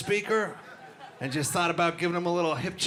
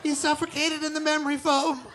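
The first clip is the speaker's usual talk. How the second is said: fake innocent wife; 11 semitones higher than usual. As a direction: play the sweet, blameless widow. Wife fakes innocence to cops.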